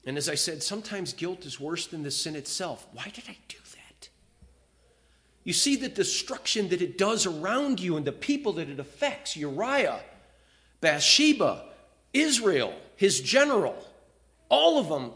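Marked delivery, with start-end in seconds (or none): 2.9-4.1 whispered, as if asking himself in shame; guilty whisper. Retold or imitated. Preacher voicing a guilty man, in a whisper. 9.4-15.1 emphatic preaching. Real, not acted. Preacher lists those affected.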